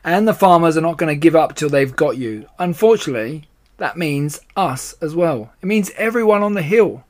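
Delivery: angry storybook badger; furious. A narrator's reading. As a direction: lay the blame on him, worked up. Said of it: "said Badger furiously".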